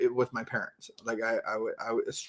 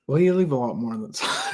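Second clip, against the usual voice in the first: teasing laugh. Real, not acted. Teasing back, breaking into laughter.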